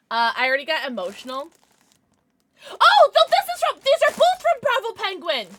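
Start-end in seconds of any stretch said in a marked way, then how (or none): 2.7-5.5 loud, high yelp of realisation; flustered outburst. Real, not acted. Streamer realising both gifts are from one viewer, flustered yelp. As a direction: cry out high as the mistake hits. Corrects who sent the plushies.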